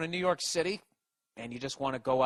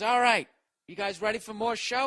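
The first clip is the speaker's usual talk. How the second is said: higher, louder crowd-pumping MC; hype emcee; 8 semitones higher, 5 dB louder than usual. Retold or imitated. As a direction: whip the crowd up. Host pumps up the crowd.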